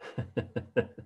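soft chuckle to oneself; a short, quiet laugh. Real, not acted. Amused by a listener's question.

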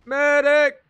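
loud call for a medic; man down shout. Real, not acted. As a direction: yell across the battlefield. Calling for medic in battle.

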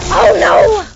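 loud, high burst of panic; panicked shout. Real, not acted. Gamer caught off guard by an enemy, panicked shout. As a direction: yell it as you get caught.

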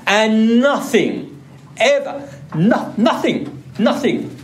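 nothing and ever stretched out and pitched high; slow, soaring emphasis on every word; preacher promising nothing takes eternal life away; emphatic nothing. Shown as a demonstration.

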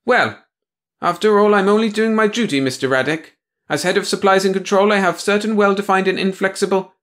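high, prim and offended; stiff officious woman. Acted. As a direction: bristle with official pride. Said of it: "The blonde stiffened".

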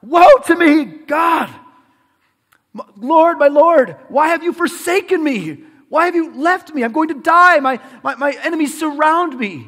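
anguished high shout, acting out a desperate prayer; hammed-up lament, very high and loud; crying out to God. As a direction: cry to heaven at full volume. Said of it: "cries out to God".

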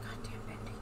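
whispered under the breath; whispered curse. Real, not acted. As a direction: hiss it under your breath. Muttering at the game.

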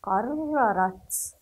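"قررت" very slow, with the doubled راء held; slow model word. Shown as a demonstration. Teacher demonstrates the shadda on raa, slow and firm. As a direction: stretch "قررت" and press the راء. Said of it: "هذا هو الحرف المشدد".

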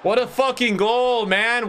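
high, slowed excited yell; hyped shout. Real, not acted. Streamer cheering a teammate's goal, excited.

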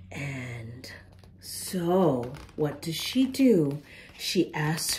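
hushed bedtime whisper. A narrator's reading. Reading a bedtime book to children, whispering softly. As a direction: breathe the words, no voice.

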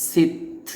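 সিত with a puff of air after the t; breath-release hams. Shown as a demonstration. Teacher shows learners the airy sound, breath-release hams. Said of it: "আওয়াজ বের হবে, এরকম".

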